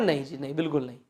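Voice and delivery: quiet, low no-no-no; soft refusal. Shown as a demonstration. Teacher demonstrating refusal, softly. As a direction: softly turn it down. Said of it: "हम किसी चीज के लिए मना करते हैं".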